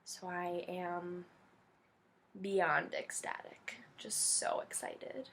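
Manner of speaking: slow, choked-up whisper; happy tears in a soft slow whisper; teary whisper. Real, not acted. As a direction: barely get it out, welling up with joy. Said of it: "I've been an emotional wreck, if you can't tell".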